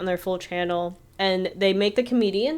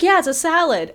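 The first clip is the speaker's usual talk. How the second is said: high, loud mock insistence; mock defensive; 10 semitones higher, 5 dB louder than usual. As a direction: insist brightly, imitating someone defending themselves. Retold defence of sad lunch.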